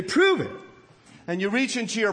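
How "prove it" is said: high, louder demand as store security; acted-out suspicious demand; sharp challenge. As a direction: challenge them like a guard demanding proof.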